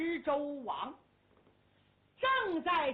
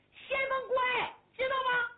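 put-on brash young general; 11 semitones higher, 4 dB louder than usual. 少年先锋官自报身份.

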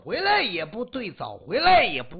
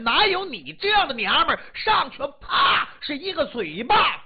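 indignant outburst; 7 semitones higher than usual. Acted. Husband shouting back at his wife, rising fury. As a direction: fire back until you snap.

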